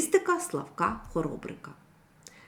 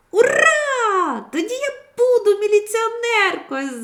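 put-on much higher, loud joyful little girl cry; overjoyed girl; 9 dB louder than usual. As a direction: burst out with delight. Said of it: "зраділа дівчинка". (радісний вигук дівчинки, високо й гучно)

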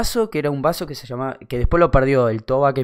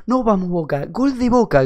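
raised, high mock yell; retold holler, climbing in pitch; imitated fan shout; 8 semitones higher than usual. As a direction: cheer like the rowdy fan. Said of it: "gritó".

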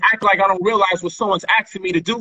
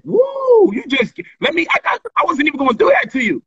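mock excited taunt; 8 semitones higher than usual. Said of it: "I said".

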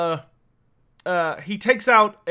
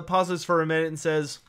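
ordinary talk, nothing marked

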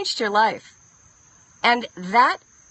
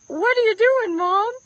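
puzzled daughter; 9 semitones higher than usual. Retold or imitated. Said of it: "Johanna would look up at me like".

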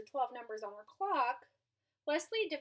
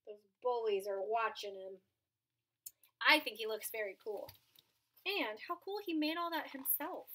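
plain reading voice

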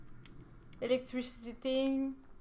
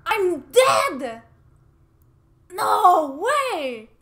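higher and louder dismay; loud disbelief; 6 semitones higher, 11 dB louder, much slower than usual. Real, not acted. Player realising she died, loud disbelieving dismay. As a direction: wail in disbelief. Reacting to dying.

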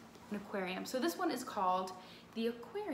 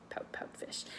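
whispered title; 9 dB quieter than usual. Shown as a demonstration. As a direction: breathe the name in a secret whisper.

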